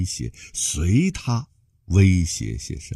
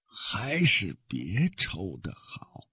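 slow, soft hissed threat; hissing whisper; 3 semitones higher, 7 dB quieter, slower than usual. Acted. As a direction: hiss it through the teeth. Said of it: "那个大胡人嘶嘶地说".

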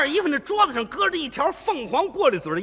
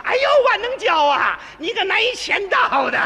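high-pitched mock woman's tease; teasing woman's voice; 8 semitones higher than usual. Sister-in-law teasing the narrator in a high voice. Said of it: "什么口吻呢".

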